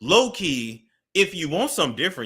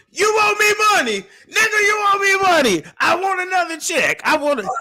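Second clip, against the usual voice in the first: very high, loud mock screeching; cussing someone out; 7 dB louder than usual. Guest imitating Tokyo demanding money, high shouted rant. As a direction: screech demands like an enraged creditor. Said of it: "she already cussed me out so bad".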